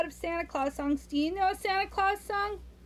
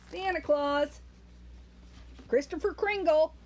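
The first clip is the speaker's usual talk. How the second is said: plain reading voice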